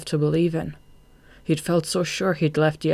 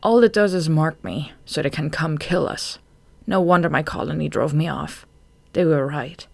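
plain reading voice

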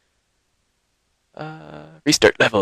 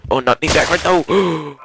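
alarmed protest; 7 semitones higher, slower than usual. Real, not acted. Character leans the wrong way.

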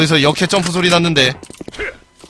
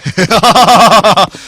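short laugh; 2 semitones higher, 7 dB louder than usual. Real, not acted. Laughing after winning.

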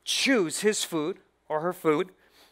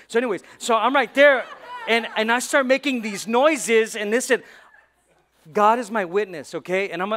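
excited laughing; 4 semitones higher, 7 dB louder than usual. Real, not acted. Excited retelling of cow call.

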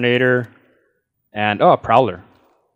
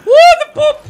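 high excited yell; excited whoop; 10 dB louder than usual. Real, not acted. Excited whoop mid-fight.

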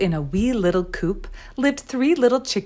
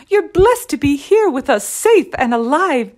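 high, loud, emotional relief; tearful mama hen; 6 semitones higher, 5 dB louder than usual. A narrator's reading. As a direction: cluck over the chicks safely home. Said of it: "clucked their ma as she cried".

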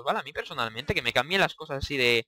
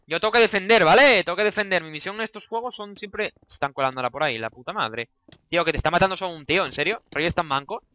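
ordinary talk

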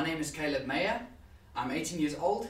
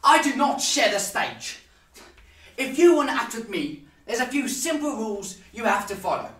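self-important stage diva; 7 semitones higher, slower than usual. Acted. Star actor ordering a rival upstage, haughty. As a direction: lord it over a lesser actor.

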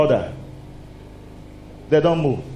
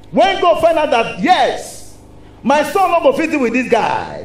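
God's shout; 7 semitones higher than usual. Acted. God declaring about his son, shouted high.

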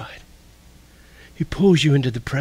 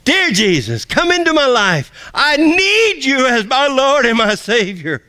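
shouted to the heavens; 10 semitones higher, 5 dB louder than usual. Shown as a demonstration. Preacher showing how to shout the salvation prayer to heaven, shouting. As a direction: belt the plea up to the heavens. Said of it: "Shout it to the heavens".

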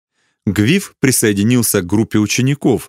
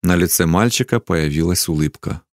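ordinary delivery

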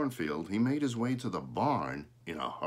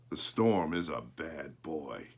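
calm father; 5 semitones lower, slower than usual. A narrator's reading. Father answers calmly.

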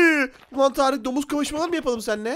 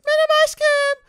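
put-on squeaky high-pitched spider girl, slow and sweet; girly high spider girl voice; 13 semitones higher, 4 dB louder than usual. (çok ince sesli örümcek kız)

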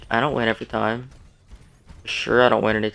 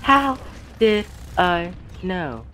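How squeaked very high, then did I know dragged out slowly; high drawn-out disbelief at the kill; stunned disbelief; 6 semitones higher, much slower than usual. Real, not acted. Gamer stunned by a sudden death, high and slow. Surprised at dying to Hanzo.

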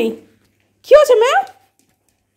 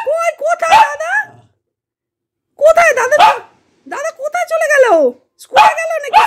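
high, sing-song talk to a pet; 5 dB louder than usual. Real, not acted. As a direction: coax the pet in a high playful voice. Calling pets playfully. (উঁচু গলায় পোষ্যের সঙ্গে আদুরে কথা)

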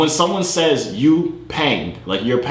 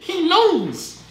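very high-pitched triumphant squeal with laughter; gleeful squealing; 14 semitones higher, slower than usual. Real, not acted. Celebrating that a stranger believed him, high gleeful squeal. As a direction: squeal it slowly, drawn out, giddy with triumph.

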